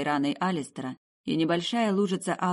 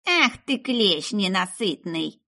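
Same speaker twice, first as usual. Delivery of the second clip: put-on high, loud old witch, then drawn-out slow curse; witch cursing; 4 semitones higher, 5 dB louder, much slower than usual. A narrator's reading. Witch cursing the ruby beetle, high and slow. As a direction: spit it at the bug like a crone.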